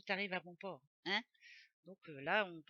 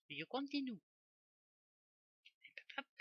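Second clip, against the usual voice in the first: put-on "Je continue" in a playful southern French accent, continue as "continu-é"; mock accent du sud; 7 semitones higher than usual. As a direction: slip into a light Midi accent. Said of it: "J'adore l'accent du sud".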